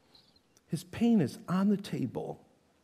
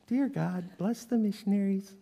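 mock polite prayer; 2 semitones higher, 6 dB quieter than usual. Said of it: "Not an ounce of feeling there".

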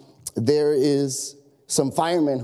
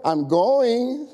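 higher, drawn-out protest with a lift; exasperated preteen; 7 semitones higher than usual. Retold or imitated. Preteen daughter answering her nagging parent, exasperated. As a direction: imitate a preteen putting Dad off. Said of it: "get on my last nerve".

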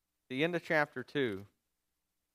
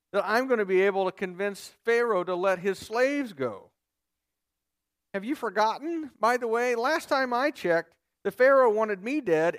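sarcastic complaint, much higher and louder; sarcastic Moses; 7 semitones higher, 5 dB louder than usual. Retold or imitated. Preacher voicing Moses mocking God's mission, in sarcastic disbelief. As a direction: scoff at the absurd request, voice up high. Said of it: "he's gotta be thinking something like this".

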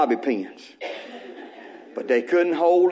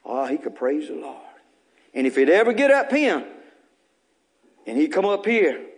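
usual speaking voice

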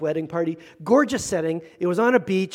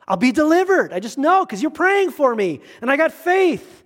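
higher, mock-certain believer; confident believer; 7 semitones higher than usual. Pastor mimics someone trusting prayer for rescue, lightly hammed. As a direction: play a sure-of-it churchgoer.

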